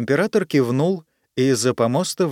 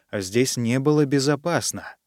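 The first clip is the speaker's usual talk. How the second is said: plain reading voice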